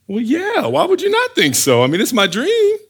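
high-pitched, as if it were obvious; oblivious self-assurance. Acted. Joseph answering his doubting brothers, high oblivious certainty. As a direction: pitch it way up in innocent surprise. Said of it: "you can imagine his face, like".

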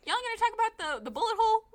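high, loud, exasperated call-out; incredulous mock questioning. Viewer heckling the show over the ignored clues, incredulous. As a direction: pitch it way up in loud disbelief.

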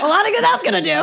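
quick, high, laughing sarcasm; sarcastic laughing quote. Retold or imitated. Mocking the heroine's plan to a friend: fast laughing sarcasm. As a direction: toss it off with a scoffing laugh. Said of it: "And I was like".

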